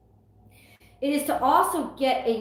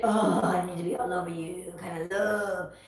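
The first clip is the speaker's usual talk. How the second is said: lightly mocking a needy lover, a touch lower; mock clingy lover; 4 semitones lower than usual. Tarot reader lightly mocking shallow clingy love. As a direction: sneer a little at shallow love. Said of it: "shallow, stupid".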